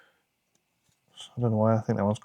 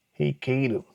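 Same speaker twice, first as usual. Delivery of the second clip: terrible American accent; 2 semitones higher than usual. Shown as a demonstration. Card collector trying out a name in a terrible American accent. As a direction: attempt at a bad American accent. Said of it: "best attempt at a terrible American accent".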